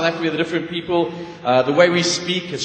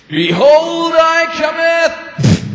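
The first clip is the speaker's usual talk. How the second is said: higher, louder and slow pulpit shout; preacher's shout; 10 semitones higher, 7 dB louder, much slower than usual. Acted. Preacher getting no reaction, slow loud high shout. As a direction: shout it slowly to wake the congregation. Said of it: "No, more emphasis".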